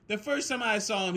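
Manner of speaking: ordinary talk, nothing marked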